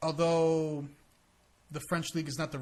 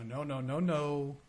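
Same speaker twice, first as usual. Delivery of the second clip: a hint of a Russian businessman refusing; Russian accent; 2 semitones lower, 4 dB quieter than usual. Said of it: "I guess it's with a Russian accent".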